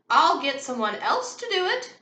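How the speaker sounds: high, loud outbidding cry. A narrator's reading. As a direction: call out over everyone. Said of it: "cried Esme Squalor".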